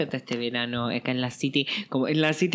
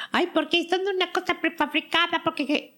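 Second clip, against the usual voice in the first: much higher nasal-free snob whine; mocking whiny critic. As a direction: parody the pop haters.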